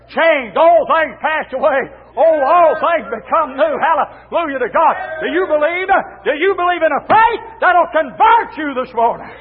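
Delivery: rising, fervent pulpit delivery; impassioned revival exhortation; full-force preaching. Real, not acted. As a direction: preach it out with punched phrases.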